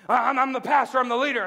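loud, stammered self-important objection; boastful mock protest. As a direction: protest your rank, hammed-up. Mock protest against God's reply.

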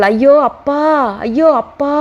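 put-on a child's pained shriek; shrieking cry. A narrator's reading. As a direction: wail out as the blows land. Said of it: "என்று வீறிட்டாள்".

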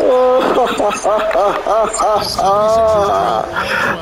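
long gleeful laugh. Real, not acted. Gamer laughing at his beaten opponent, gleeful laugh. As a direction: cackle at the other player's misery.

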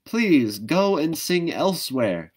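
sad plea. Acted. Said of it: "she said".

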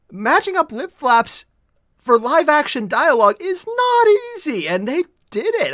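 loud, high, emphatic amazement; blown away. Real, not acted. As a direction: hammer each phrase slowly, voice climbing. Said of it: "I'm blown away by that".

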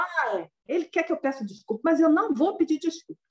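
high, heated refusal, digging in; an angry ascetic who won't say sorry; stubborn angry refusal. Retold or imitated. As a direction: refuse to apologise, half-hearted mock fury. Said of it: "muito bravo".

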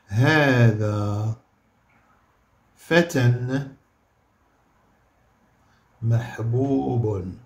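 هذا فتى محبوب said slowly; slow model sentence; slow dictation. Shown as a demonstration. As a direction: dictate word by word. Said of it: "اش غتولي؟".

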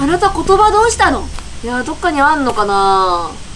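ordinary talk

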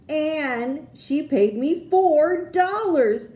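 put-on child thrilled at finally reaching twenty dollars; building excitement. A narrator's reading. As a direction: climb to the big reveal. Child reaching her savings goal.

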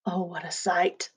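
plain reading voice

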